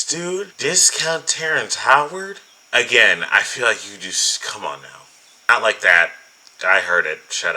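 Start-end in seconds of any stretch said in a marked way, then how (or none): 0.0-2.4 high, slow, incredulous question; disbelieving. Real, not acted. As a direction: stretch the name in disbelief. Incredulous repeat.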